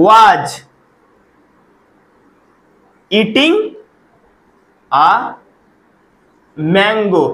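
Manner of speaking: each word spaced out with long pauses; slow demo sentence. Shown as a demonstration. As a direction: dictate it word by word, slowly. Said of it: "ওই মেয়েটারই যখন আমার কথা বলবো".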